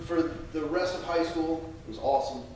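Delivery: ordinary talk, nothing marked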